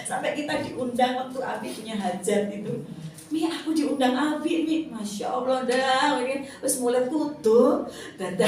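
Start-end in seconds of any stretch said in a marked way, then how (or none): 3.3-4.8 raised, louder retold excitement; excited announcement. Teacher telling her friend of an invitation, excited announcement. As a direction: share big news eagerly.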